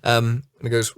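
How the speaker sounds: usual speaking voice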